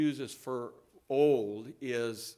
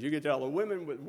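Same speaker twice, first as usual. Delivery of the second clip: usual speaking voice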